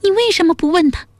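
high, quick princess voice, flustered with anger; high-pitched, fast, exasperated question. A narrator's reading. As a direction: voice a frustrated princess demanding an explanation. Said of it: "江米气急败坏地说".

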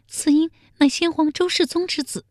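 very high-pitched maid's reply; timid palace maid. A narrator's reading. Maid tells the emperor whose baby it is, timid. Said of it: "宫女小声回道".

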